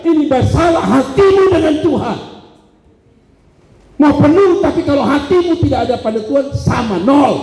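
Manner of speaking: high, forceful preacher voicing a correction; God's rebuke. God correcting the preacher about full seats, high forceful rebuke. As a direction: call out sharply, then press each point. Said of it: "Lalu Tuhan meluruskan saya".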